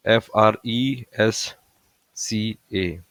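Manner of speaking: very slow, each letter spaced apart; spelling out letter by letter. Shown as a demonstration. Teacher spells the trick for students, very slowly. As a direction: spell the mnemonic one letter at a time. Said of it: "उसकी trick है".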